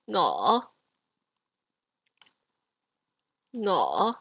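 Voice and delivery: Ngo from low rising to mid. Shown as a demonstration. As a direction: lift Ngo gently from low to middle. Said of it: "So rise to the middle".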